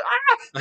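high, tearful laughing squeal; imitated laughing shriek. Friend pointing at him, high laughing squeal. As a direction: yelp like you're crying with laughter. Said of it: "he's laughing, like, tear".

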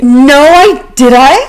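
genuinely taken aback; real surprise. Real, not acted. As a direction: blurt it out, caught off guard. Caught out by friend's accusation.

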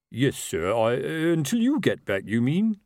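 halting, stumbling reply climbing in pitch; uncertain sergeant. Acted. Sergeant to captain on being left in charge, uncertain. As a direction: query the order hesitantly.

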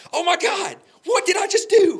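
high, loud shout of shock at himself; panicked shout. Retold or imitated. Realizing his mistake in the car, shouting in shock. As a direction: yell it out in sudden horror. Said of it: "I'm like".

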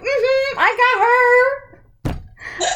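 high, drawn-out gloating through laughter; laughing mock victory singsong; gleeful imitation. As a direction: tease him with a squealed, triumphant impression. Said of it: "Look at his face. He's so happy".

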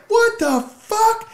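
very high, slow, loud outcry; teen shouting in shock at Danny, high and slow. Retold or imitated. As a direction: cry out in horror. Retelling his own panic.

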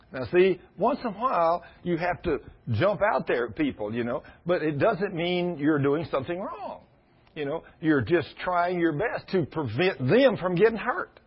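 ordinary talk, nothing marked

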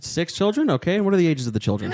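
quick, lilting agent questions; high surprised echo, then brisk booking patter; customer service voice. Shown as a demonstration. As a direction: keep it professional like a phone clerk. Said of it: "Can we hear your customer service voice?".

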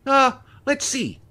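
high, slow musing woman; woman voice, thinking. Acted. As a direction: stall while she thinks. Sally voiced.

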